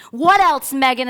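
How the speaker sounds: loud, high, insistent shout; forceful, escalating prompt; shouted self-demand. Retold or imitated. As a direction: holler it harder at yourself. Acting out screaming alone in car.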